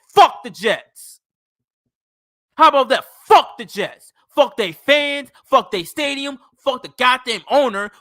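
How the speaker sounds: loud, furious shouting at the Jets; angry rant. Real, not acted. Fed-up fan yelling at the Jets, loud and furious. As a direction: yell it out, fed up.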